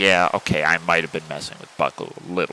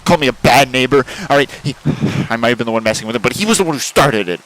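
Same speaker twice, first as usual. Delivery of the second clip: angry rant; 4 semitones higher, 5 dB louder than usual. Acted. Ranting after the quarrel.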